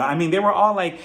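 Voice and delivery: ordinary talk, nothing marked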